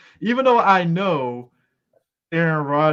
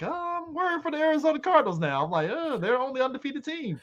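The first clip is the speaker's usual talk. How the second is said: sarcastic fake worry, pitched up; mock worry; 11 semitones higher, 3 dB quieter than usual. Fan mocking worry about the Cardinals, high sarcastic voice. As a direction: lightly play the nervous fan, high and mocking.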